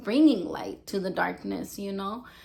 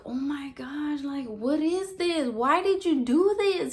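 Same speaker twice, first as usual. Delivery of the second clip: higher-pitched retold shock; horrified disbelief; 6 semitones higher than usual. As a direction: imitate an appalled friend questioning. Retells friend's shock at the altar.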